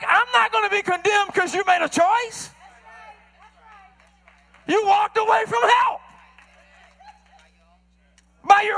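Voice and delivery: high, loud, climbing preacher; preaching. Acted. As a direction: rise to a pulpit climax. Preaching at full force.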